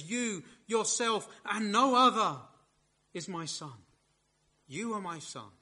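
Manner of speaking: "You" pressed hard, as if no one else could be meant; the Father singling out his Son, you pressed hard; emphatic you. Shown as a demonstration. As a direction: stress "You" and "yourself". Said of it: "it's as if God the Father says".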